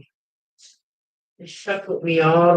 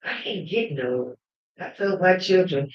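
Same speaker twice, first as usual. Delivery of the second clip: usual speaking voice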